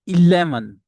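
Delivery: ELEVEN opening on a clear EE, loud and high; correct eleven. Shown as a demonstration. Teacher models the number for students, emphatic first vowel. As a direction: punch ELEVEN with an initial ih-LEV. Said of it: "it is eleven".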